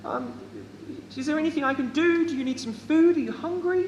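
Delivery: very high-pitched fussing woman; high, anxious-to-help hostess voice; fussing lady. Acted. Game master plays fussing lady.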